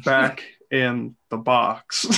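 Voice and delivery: slow, drawn-out Cage delivery; Nicolas Cage voice. Acted. Mario warning Walton, in a slow Nicolas Cage voice. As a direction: stretch it out like Cage. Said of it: "the Nicolas Cage voice".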